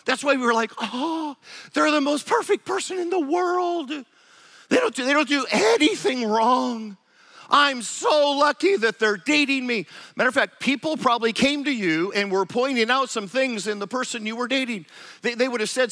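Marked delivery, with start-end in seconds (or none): 0.8-9.9 high, dreamy, lovestruck gush; mock infatuated sigh, a bit louder and high; starry-eyed gushing. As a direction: swoon over them like they can do no wrong. Infatuated partner gushing about spouse.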